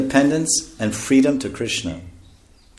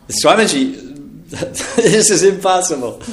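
high-pitched British lord protesting; British accent; 4 semitones higher, slower than usual. Retold or imitated. English lord protests to the swami, in a British accent. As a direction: plead in a put-on British accent, amused. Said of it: "It is best British accent".

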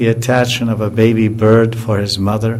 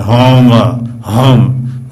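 louder mooing of the mother cow; low Homa like a calming cow; deep cow voice; 6 dB louder, much slower than usual. Retold or imitated. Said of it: "She got a very deep voice".

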